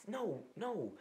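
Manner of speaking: high-pitched, exasperated refusal; put-on high wail of protest; mock frustrated protest. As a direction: act out pleading with a useless assistant. Acts out protest at Siri's mistake.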